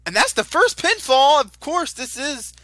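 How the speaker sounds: loud, high excited shouting; lucha libre announcer. Real, not acted. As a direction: scream the call at the pinfall. Live play-by-play of the pinfall.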